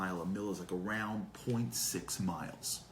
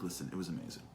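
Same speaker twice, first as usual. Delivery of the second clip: low, soft, settled statement; unreserved praise; 4 semitones lower, 6 dB quieter, much faster than usual. Acted. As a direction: say it simply and softly, no reservations. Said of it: "just turn around and be like".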